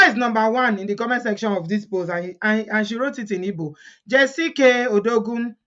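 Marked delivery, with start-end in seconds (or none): none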